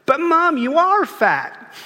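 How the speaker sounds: put-on high, slow little toddler voice; tiny child's voice. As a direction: pipe up like a three-year-old. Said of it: "this tiny child's voice".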